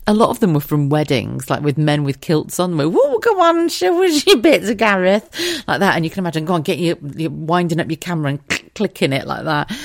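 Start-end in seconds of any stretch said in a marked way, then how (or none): 3.0-5.3 high, loud, slow teasing cheer; cheeky heckle. Retold or imitated. Older ladies heckling the groom in his kilt, high and loud. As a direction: egg him on, cheeky and loud.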